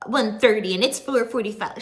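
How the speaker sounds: a hint of mocking Greg's gripe; complaining Greg. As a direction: lightly mimic a whiny complainer.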